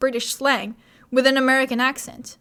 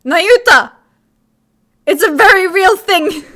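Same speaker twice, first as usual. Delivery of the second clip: outraged shout; 7 semitones higher, 11 dB louder than usual. Acted. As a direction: yell it out in protest, much louder and higher. Witness defends her claim, outraged.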